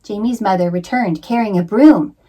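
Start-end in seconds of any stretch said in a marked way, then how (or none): none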